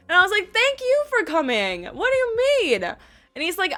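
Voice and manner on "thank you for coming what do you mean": incredulous. Retold or imitated. Incredulous reply to his thanks.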